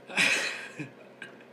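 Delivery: airy laugh trailing off; breathy laugh. Real, not acted. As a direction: let the laugh spill out. Still laughing at joke.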